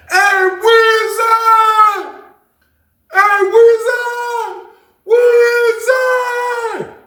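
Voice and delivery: long drawn-out high yell across the yard; calling out from afar. Retold or imitated. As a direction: stretch the name out, shouting to someone far off. Someone yelling his name from afar.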